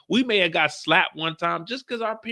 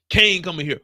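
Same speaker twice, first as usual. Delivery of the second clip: ordinary delivery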